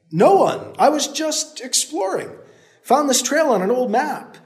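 high, called downhill; calling back. Acted. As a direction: call it down to him. Hero calling down an answer.

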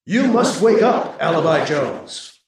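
slow, urgent alien command; sharp and alert. A narrator's reading. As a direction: snap him awake from afar. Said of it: "to sharp and alert".

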